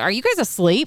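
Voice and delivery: mildly scolding question, rising at the end; a touch of the exasperated host; mock scolding. Retelling the host's words to her audience, mild scolding. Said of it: "Like, scolded us".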